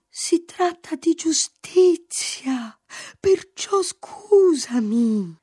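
high, slow, breaking cry; thread of voice. A narrator's reading. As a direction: strain out a choked cry. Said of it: "Con un filo di voce e insieme un asciutto grido". (un filo di voce, grido strozzato)

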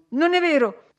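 loud, high-pitched denial; indignant cry. A narrator's reading. Catherine, finding her voice, cries out her denial to Edgar. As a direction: cry out in protest, voice leaping up. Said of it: "gridò lei ritrovando la voce".

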